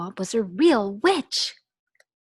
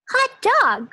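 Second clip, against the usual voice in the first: high, loud cheer; excited cry; 10 dB louder than usual. Acted. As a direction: whoop with joy. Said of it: "cried the kids".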